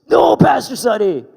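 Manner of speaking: high, loud yelp of no; shouted refusal. Retold or imitated. Bob fleeing the pastor's office, yelling no. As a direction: yell it in panic. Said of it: "He goes".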